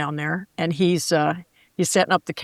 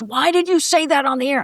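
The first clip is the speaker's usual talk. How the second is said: boss's demand; 7 semitones higher than usual. Retold or imitated. Boss confronting her over an on-air mistake, higher demanding voice. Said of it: "He goes".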